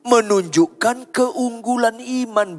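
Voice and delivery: high, loud, ringing proclamation; full-force preaching. Real, not acted. Preacher voicing the woman's faith before the congregation, proclaiming fervently. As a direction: cry the verse out to the whole room.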